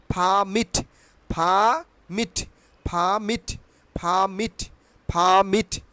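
"permit" as "phaa-mit", R silent; repeat-after-me model. Shown as a demonstration. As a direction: stretch the first syllable of "permit", puffed P. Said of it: "আমার সঙ্গে বলো". ("permit"-কে ফা-মিট বলে)

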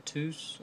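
Teus said TOOS; others' pronunciation. Shown as a demonstration. Host cites what some people call it, Teus said TOOS. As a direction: say Teus as one syllable, "TOOS". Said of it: "some people call him".

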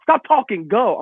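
high-pitched urgent mock yell; imitated yelling. Fourth grader hurrying mom at the car, mock yelling. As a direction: act out a frantic kid yelling orders. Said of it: "And I'm yelling".

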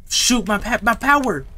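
high, stumbling exclamation; alarmed cry. Real, not acted. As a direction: blurt it out in sudden alarm. Power suddenly cuts out.

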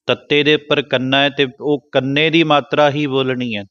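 ordinary delivery

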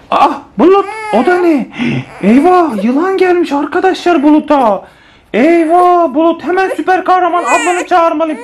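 high, dramatic pretend panic; high-pitched make-believe emergency; mock alarm. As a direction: raise the alarm in playful horror. Playful snake emergency for the baby.

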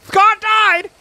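high-pitched shocked exclamation; alarmed cry. Real, not acted. Alarmed reaction to game death.

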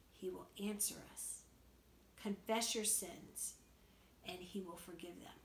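a teacher reassuring the class that God will forgive; soft reassurance. Real, not acted. Quiet reassurance about God's forgiveness.

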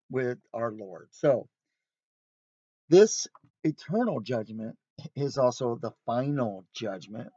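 ordinary delivery

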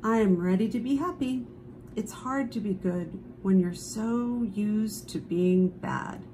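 ordinary delivery, nothing marked

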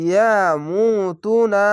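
ইয়ামুতুনা slowly, long "moo" and long "too"; one-alif elongation. Shown as a demonstration. Teacher modelling a Quran word, slow ইয়ামুতুনা with long "oo" vowels. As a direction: draw out both "oo" vowels in ইয়ামুতুনা. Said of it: "এক আলিফ টেনে পড়বেন".

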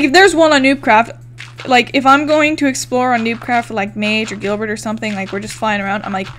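ordinary delivery

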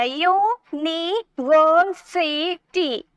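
University very slow, five separate syllables. Shown as a demonstration. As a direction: pause after each piece, yu, ni, ver, si, ti in university. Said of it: "five syllable हो गए".

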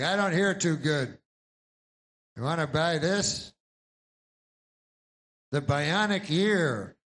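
put-on an old star hawking a hearing aid; aging actor selling. Old actor selling the bionic ear to viewers, loud and slow.

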